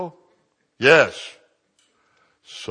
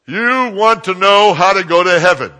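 loud, high question to the whole cabin; said real loud; 7 semitones higher, 9 dB louder than usual. Retold or imitated. Preacher loudly asking the passenger about heaven. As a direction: project it over every row. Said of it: "I said it real loud".

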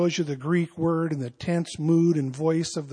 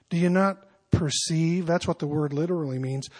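ordinary talk, nothing marked

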